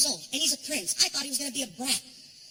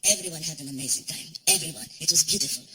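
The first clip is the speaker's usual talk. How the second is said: low, measured and formal; gracious prince; 9 semitones lower than usual. Retold or imitated. Prince thanking the comic for the shows, low. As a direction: speak low and kindly like royalty. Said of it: "He's like".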